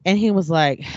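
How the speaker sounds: ordinary talk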